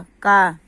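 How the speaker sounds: "का" said as one loud blended syllable, क plus आ; joined syllable demo. Shown as a demonstration. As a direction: sound out "का" loudly as the joined result. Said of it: "क plus आ". ("का" को ज़ोर से जोड़कर बोलना)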